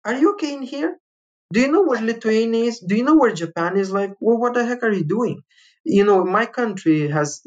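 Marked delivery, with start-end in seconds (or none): 0.0-5.4 higher, talking down as if to a fool; condescending official doubting his sanity; retold officials, incredulous and patronising; mocking disbelief. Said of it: "It's like".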